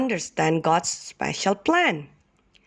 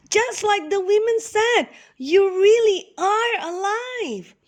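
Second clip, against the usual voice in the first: joyful amazement. Acted. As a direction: marvel that he lives. Helpers greet Jesus.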